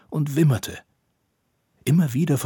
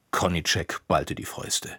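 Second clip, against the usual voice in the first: plain reading voice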